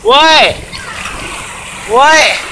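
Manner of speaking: loud, high startled what; shocked yelp as the wave hits. Real, not acted. Hit by a cold wave.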